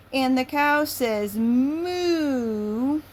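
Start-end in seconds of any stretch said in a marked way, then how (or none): 1.4-3.0 a sing-song cow moo; hint of a cow mooing; lightly voiced cow. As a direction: answer like a friendly cow. Cow answers in the story.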